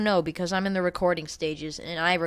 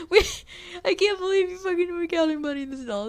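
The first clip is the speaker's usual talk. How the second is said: mock crying; 9 semitones higher than usual. Mock wail over the betrayal.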